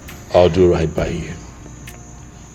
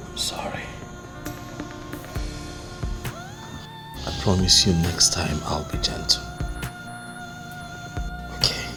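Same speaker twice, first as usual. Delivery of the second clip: whispered apology; 7 dB quieter than usual. Acted.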